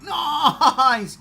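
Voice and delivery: loud gleeful Nice; laughing whoop; excited cheer. Retold or imitated. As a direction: shout it like a fan spotting a hero. Cheering at cameo reveal.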